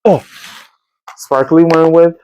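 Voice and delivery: ordinary delivery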